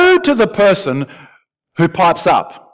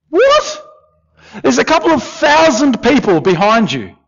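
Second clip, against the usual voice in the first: incredulous what; 7 semitones higher than usual. Acted. Preacher's incredulous reaction.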